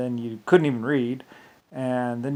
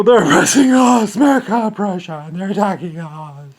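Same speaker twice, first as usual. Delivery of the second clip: high, loud mocking shout; rally the crowd with fake outrage; 7 semitones higher, 11 dB louder than usual. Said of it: "he's telling his people".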